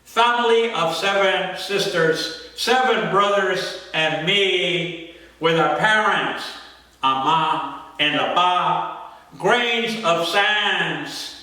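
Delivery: slow, drawn-out incantatory poem reading; poem recitation. Acted. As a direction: recite solemnly, stretching words, pausing between lines.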